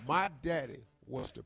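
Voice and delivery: normal talking voice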